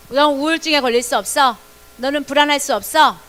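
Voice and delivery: loud, firm order to the body; loud command. Shown as a demonstration. Children declare to their own body, loud command. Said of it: "빨리 시작".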